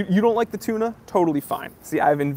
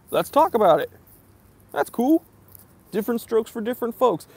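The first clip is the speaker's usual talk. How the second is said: raised, lightly breezy reply; easygoing acceptance; 7 semitones higher, 4 dB louder than usual. Retold or imitated. Reviewer answering people who don't like Seiko, easygoing and high. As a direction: brush it off in a higher, easy voice.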